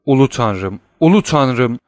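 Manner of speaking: ordinary delivery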